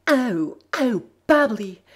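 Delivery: high, loud breathless gasps; gasping cry. Acted. Twilight gasping Bubbly's name, high and loud. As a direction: gasp her name, overwhelmed. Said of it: "she gasped".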